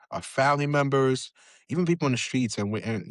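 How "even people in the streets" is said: normal talking voice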